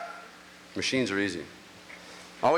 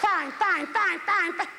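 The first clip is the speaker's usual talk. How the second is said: much higher, repeated; exasperated high fines; slower than usual. Retold or imitated. Wife giving up on the hubcaps, high and exasperated. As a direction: fling them out in surrender.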